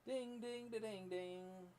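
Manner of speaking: high, slow sung-out dings; imitated chime. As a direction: ring it out like a little bell.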